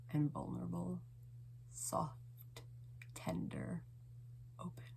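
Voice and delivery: fading to a near-whisper; tender hush. Acted. Poem turns to bare tenderness.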